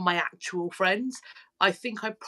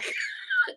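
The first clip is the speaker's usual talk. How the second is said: sudden high laugh. Real, not acted. Laughing at a joke T-shirt, high yelp.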